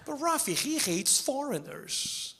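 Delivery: a hint of a slow, doubtful heckler; mildly mock a doubting fan; lightly voiced skeptic in the crowd; imitated objecting audience member. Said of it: "maybe you guys are thinking".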